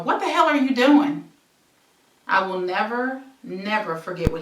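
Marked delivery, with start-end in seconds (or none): 0.0-1.3 startled, loud demand; retold yell. Woken by a stranger, yelling at him loudly. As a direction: shout it at the man in your bed. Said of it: "sit up and yell".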